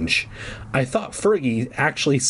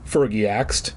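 ordinary talk, nothing marked

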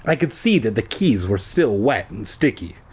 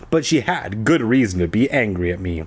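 ordinary delivery

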